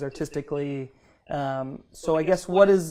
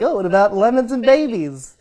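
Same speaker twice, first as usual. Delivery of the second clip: mock chirpy question; 7 semitones higher, 6 dB louder than usual. Mocking her own derailing question.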